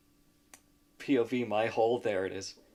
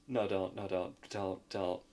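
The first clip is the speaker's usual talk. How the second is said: normal talking voice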